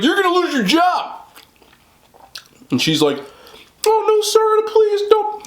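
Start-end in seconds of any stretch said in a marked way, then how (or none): 0.0-1.2 high-pitched threatening holler; imitated yelling. Homeowner threatening the delivery lady, imitated angry yell. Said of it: "I'm sorry I'm yelling". 3.9-5.4 high, pleading imitation of a woman; mock begging. Busted delivery lady begging homeowner for mercy, mock pleading.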